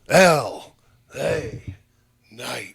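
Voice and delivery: drawn-out announcer-like impersonation, falling away at the end; Val Venus. Acted. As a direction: stretch the name out in a put-on voice. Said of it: "you made him sound like Val Venus".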